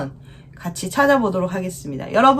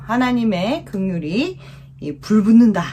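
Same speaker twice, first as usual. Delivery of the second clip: ordinary delivery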